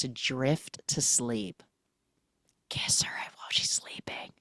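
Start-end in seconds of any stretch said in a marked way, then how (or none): 2.7-4.3 whisper. Pretend. Whispered aside.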